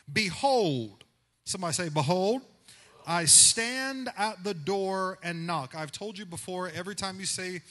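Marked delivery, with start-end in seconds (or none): 0.1-1.0 preaching. Acted. Preacher reads scripture loudly. 1.9-5.7 loud, slow, ringing proclamation; impassioned preaching. Acted. Congregation call and response.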